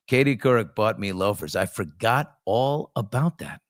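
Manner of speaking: ordinary talk, nothing marked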